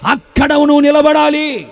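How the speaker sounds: high, ringing preacher's climax; forceful proclamation. Real, not acted. Preacher telling the believer where to stand, forceful proclamation. Sermon climax, then repeated quietly.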